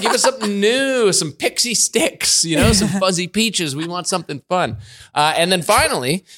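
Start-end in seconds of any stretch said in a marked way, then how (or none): 0.0-4.8 high, bratty voice of a picky monkey; cheeky monkey voice. Retold or imitated. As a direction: whine it like a spoiled monkey demanding treats. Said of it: "he's like".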